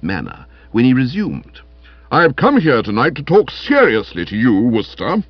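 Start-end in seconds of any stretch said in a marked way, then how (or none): 2.1-5.2 higher, louder stern rebuke; frowning, officious man's voice; stern, unpleasant scold. Acted. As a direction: lecture him with heavy seriousness. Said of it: "frowning in a most unpleasant manner".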